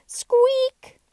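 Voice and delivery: loud, very high-pitched squeak; toy squeak. A narrator's reading. Startled Dutch doll falling over backward, loud high squeak. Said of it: "fell over backward and said".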